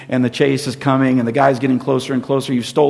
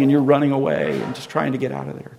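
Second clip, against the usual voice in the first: ordinary delivery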